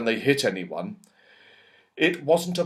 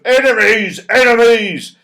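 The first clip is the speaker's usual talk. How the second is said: loud, higher cry of alarm; shouted warning; 9 semitones higher, 10 dB louder than usual. A narrator's reading. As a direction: sound the alarm again. Retelling the snowman's cry.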